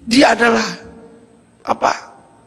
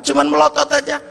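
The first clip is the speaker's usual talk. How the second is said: ordinary talk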